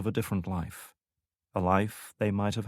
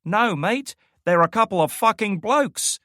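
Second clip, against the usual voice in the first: much higher, louder mock British accent; British accent impression; 13 semitones higher, 7 dB louder than usual. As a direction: ham up a British bloke. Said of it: "her best impersonation of Drake's British accent".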